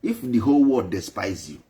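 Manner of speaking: ordinary talk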